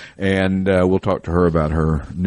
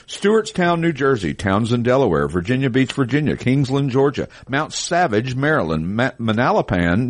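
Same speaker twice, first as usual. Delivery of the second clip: reading out place names like a roll call; proud announcer read of the sold list; 4 semitones higher than usual. Acted. Reads list of sold locations.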